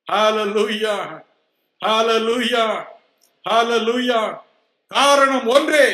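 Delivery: high, loud impassioned preaching; preaching at full force. Acted. Preacher proclaiming healing to the congregation, high and loud. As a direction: build the sermon to its climax.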